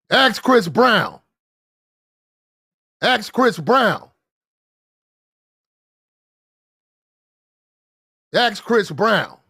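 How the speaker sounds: slow, loud, high comeback; yelled retort. Shown as a demonstration. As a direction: hit her with it, every word spaced. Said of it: "You yell at her".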